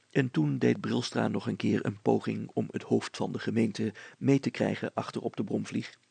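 usual speaking voice

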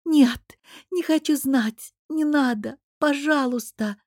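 high, loud wailing plea. A narrator's reading. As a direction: beg out of a nightmare, howling. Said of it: "Последнее я уже провыла".

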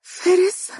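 a sudden very high, breathless gasp of his name. Acted. Mahra hearing Faris on the phone, breathless high gasp. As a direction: catch your breath and gasp the name in disbelief. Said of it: "تقول بأنفاس متقطعة".

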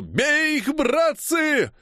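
very high, drawn-out battle cry; yelling. A narrator's reading. Striker rallying comrades against the attack, yelling. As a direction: yell a charge to comrades. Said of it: "я проорал".

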